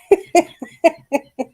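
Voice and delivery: high, breathless wheezing laugh. Real, not acted. Reader laughing at herself mid-reading, real wheezing laugh. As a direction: let the laugh squeeze out as a wheeze. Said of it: "As you can hear, I am wheezing".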